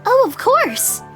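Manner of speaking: put-on high, loud, eager girl; dandelion girl's voice. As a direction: chirp it brightly as an eager girl. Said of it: "I mix up the voice".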